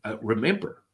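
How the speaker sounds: ordinary talk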